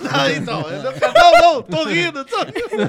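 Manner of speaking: high, laughing so hard it sounds like crying; laughing to tears. Real, not acted. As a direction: protest through helpless, tearful laughter. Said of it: "Você tá chorando, [name]?". (chorando de rir)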